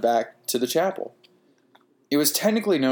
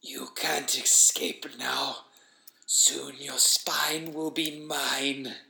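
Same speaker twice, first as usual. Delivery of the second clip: very slow whispered menace; labored creature speech; 3 semitones higher, 4 dB quieter, much slower than usual. A narrator's reading. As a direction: strain to push out each threatening word. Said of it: "how difficult it was for it to speak".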